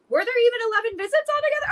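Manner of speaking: high, fast, near-tearful protest; blurting it out in panic, voice high and rushed; panicked disbelief. Retold or imitated. On the phone to an accusing nurse, panicked and high.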